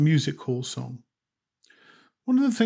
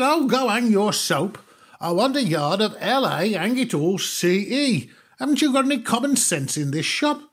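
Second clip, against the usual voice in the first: mock exasperated outburst; 7 semitones higher, 4 dB louder than usual. Customer losing patience with the shopman's soap, angry. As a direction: let him have it, last nerve gone.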